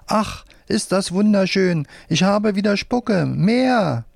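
high-pitched puppet delight; delighted cry. A narrator's reading. Soaked puppet gets its spit back, high delighted cry. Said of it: "Die schreit auf".

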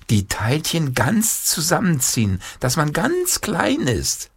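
put-on a child relishing a fanciful idea; eager boy. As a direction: squeeze the words up high and tiny. Boy eagerly joins the invention.

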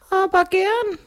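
very high-pitched ghost voice. Acted. Ghost replies in story. (hohe Gespensterstimme)

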